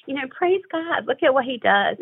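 fighting back tears while praising; real emotion welling up, voice cracks; getting emotional. Real, not acted. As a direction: voice catching, breaking high on praise God. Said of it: "I'm gonna get emotional".

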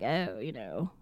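low creaky fry demo; vocal fry. Shown as a demonstration. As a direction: let it crackle at the bottom. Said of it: "I love those samples".

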